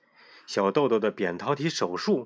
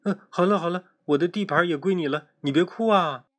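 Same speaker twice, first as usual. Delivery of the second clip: put-on higher, hurried child voice soothing; flustered comforting; 7 semitones higher, 3 dB louder than usual. A narrator's reading. Panicked girl soothes crying deskmate, higher and hurried. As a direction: rush to calm a crying friend. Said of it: "黄美美一下子慌了".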